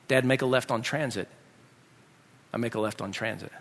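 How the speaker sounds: low, soft run through directions; subdued, orderly retelling; calm retry. Retold or imitated. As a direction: recite order and echo obediently.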